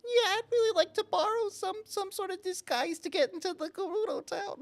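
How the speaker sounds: tiny breathy squeak of a voice; squeaky voice. Acted. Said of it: "the softest squeaky voice".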